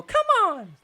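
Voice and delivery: hammed-up fist-pump yell; mock pumped-up yell. Imitating Anna Lee firing herself up, laughing. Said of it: "She gives a little".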